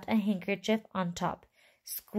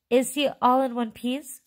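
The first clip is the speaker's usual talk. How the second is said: higher, louder worried question; anxious cry; 5 semitones higher, 5 dB louder than usual. A narrator's reading. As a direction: cry it out with mild alarm. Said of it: "cried Missus Teeve".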